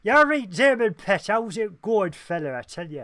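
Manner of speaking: hammed-up regional English accent, high and loud, then settling; put-on English accent. Chatting to listener Damon like family, hammed-up English accent. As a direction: greet them in an exaggerated English dialect.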